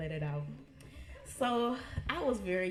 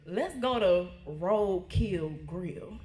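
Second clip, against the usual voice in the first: slow tease; 4 dB louder, much slower than usual. Retold or imitated. Aunt quoted proposing restaurant.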